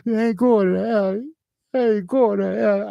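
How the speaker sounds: very high sobbing repeat; crying. Retold or imitated. Recalling the robbery, sobbing the refusal very high. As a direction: blubber it out, wailing. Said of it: "I'm just crying".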